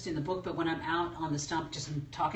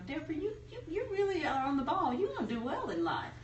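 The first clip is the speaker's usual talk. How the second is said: put-on Southern accent; 8 semitones higher than usual. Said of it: "in that Southern accent".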